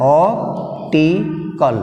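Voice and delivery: Optical stretched out slowly, stress on op; slow model word. Shown as a demonstration. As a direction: pronounce Optical very slowly for students. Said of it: "Third syllable from the end".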